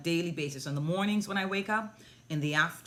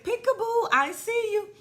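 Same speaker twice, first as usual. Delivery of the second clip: put-on very high, loud sing-song baby talk; playful peekaboo; 6 dB louder than usual. Playing peekaboo with the smiling little girl, high playful sing-song. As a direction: pop out playfully at a toddler.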